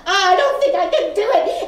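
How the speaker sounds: high, loud, overwhelmed panic; blurting out in a near shout, close to tears; panicked outburst. Retold or imitated. Retelling her panic to Catherine, frantic near shout. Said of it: "and I'm going".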